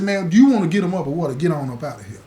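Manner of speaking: loud, pushing challenge; heated dare. Retold or imitated. To the cellmate who won't leave, loud angry dare. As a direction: dare him to fight or get out.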